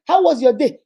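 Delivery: cheery, loud greeting; acted spouse asking loudly. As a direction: call out the question brightly. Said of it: "You say".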